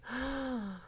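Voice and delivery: high, soft drawn gasp. Real, not acted. Vlogger reacting to the trailer, soft high gasp. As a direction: suck in a breath softly, high.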